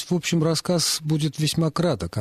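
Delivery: normal talking voice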